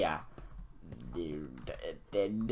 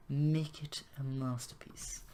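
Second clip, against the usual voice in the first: whispered; 4 semitones higher, 5 dB quieter, slower than usual. Pretend. Grand aside while building.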